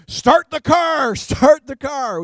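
high, drawn-out comic yell; mock getaway shout. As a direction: holler it like a robber fleeing with the loot. Retold frantic getaway with toilet paper.

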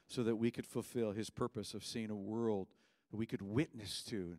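usual speaking voice

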